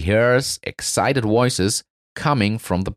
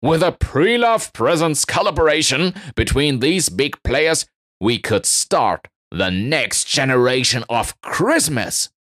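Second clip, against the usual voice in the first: announcing eagerly; 6 semitones higher than usual. Acted. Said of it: "the new guy announces".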